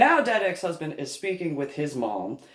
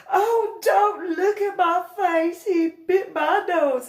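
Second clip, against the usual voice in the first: mock wail; 5 dB louder than usual. Cousin wailing about bitten face.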